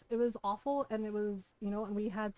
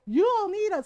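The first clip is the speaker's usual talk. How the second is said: higher, louder mocking man's voice; mock angry man; 10 semitones higher, 10 dB louder than usual. Imitating the angry man insisting women need men, loud and high. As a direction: parrot his indignant claim up high and loud. He got mad, man quoted.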